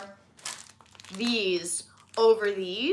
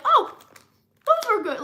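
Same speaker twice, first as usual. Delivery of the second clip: higher-pitched little "oh" of discovery; mildly surprised afterthought, pitched up; pleasantly surprised; 11 semitones higher, 3 dB louder than usual. Acted. Snacker noticing the munched snack is gone, pleasantly surprised. Imagined reaction to finished snack.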